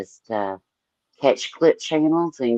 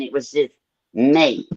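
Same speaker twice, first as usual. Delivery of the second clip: ordinary talk, nothing marked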